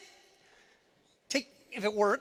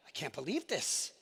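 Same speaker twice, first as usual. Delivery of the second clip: stunned murmur; 6 semitones lower, 11 dB quieter than usual. Retold or imitated. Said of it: "You're kinda".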